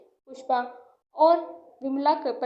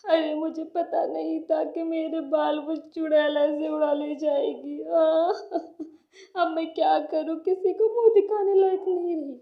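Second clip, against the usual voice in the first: crying. Acted. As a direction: sob through the regret. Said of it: "Vimla को रोते देख".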